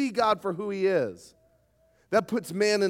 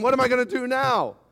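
higher, mock-panicked question; mock panicked God; 6 semitones higher, 4 dB louder than usual. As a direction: play God as a flustered planner, tongue in cheek. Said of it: "God's not up in heaven going".

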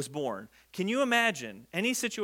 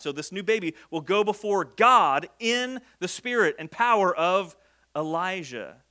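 normal talking voice

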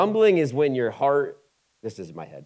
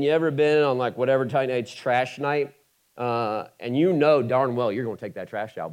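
ordinary delivery

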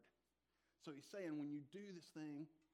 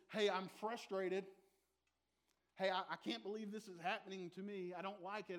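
a mock grumble about the Christmas lights; grumbling voice; 4 semitones higher, 7 dB louder than usual. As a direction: grumble like a man frustrated with his lot. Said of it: "That's grumbling".

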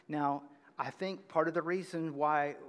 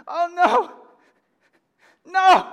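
high, very loud wail of despair; anguished weeping; 13 semitones higher, 18 dB louder than usual. Acted. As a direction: wail in horror, drawing out each no. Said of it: "one group is like this ... That's weeping".

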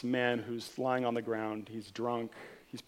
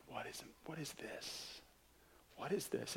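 breathless awed whisper; stunned whisper; 16 dB quieter than usual. Acted. Narrator watching the rag man die, stunned whisper. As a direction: barely breathe the question in disbelief.

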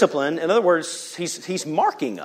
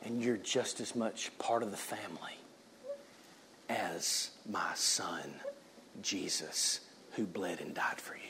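a soft, low whisper of fatherly love; tender whisper; 7 semitones lower, 18 dB quieter than usual. Acted. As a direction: whisper it like a father reassuring his child. God speaks tenderly to believer.

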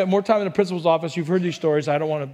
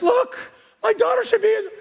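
very high mock pushy-mother voice; 4 dB louder than usual. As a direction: push your child forward in a squeaky, hammed-up plea. Mimics mothers pushing their daughters.

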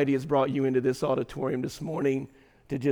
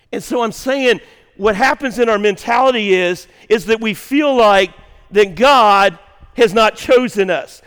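louder, building sermon preaching; preaching at full force; 6 semitones higher, 10 dB louder than usual. Acted. Pastor preaching to the congregation, full-force preaching. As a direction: drive it home to the congregation.

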